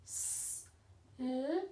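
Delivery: "S-L-I-D" as four soft separate sounds; a teacher softly segmenting "S-L-I-D" into four claps; sounding out slide. Shown as a demonstration. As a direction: quietly count out the sounds of slide. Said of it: "I had four claps".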